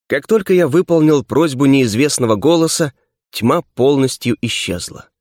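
plain reading voice

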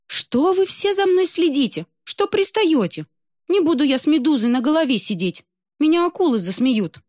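put-on high whiny pouting girl. Надувшая губы русалка жалуется отцу и альбатросу, высоко и капризно. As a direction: complain high and sulky like a spoiled little girl. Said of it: "Русалка надула губы".

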